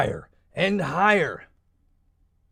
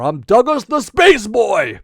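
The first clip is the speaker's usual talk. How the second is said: put-on heroic space boy; 3 semitones higher, 6 dB louder than usual. Hero introduces himself.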